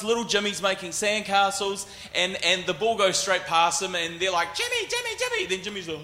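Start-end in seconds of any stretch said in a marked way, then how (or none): 4.5-5.5 high, carrying call across the field. Retold or imitated. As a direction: call the kid's name from the stands. Parents calling a kid in field.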